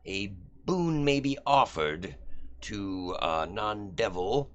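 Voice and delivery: slow, formal reading of the rules; reading bylaws. Acted. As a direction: read the clause slowly, like legalese. DM reads devil's bylaws aloud.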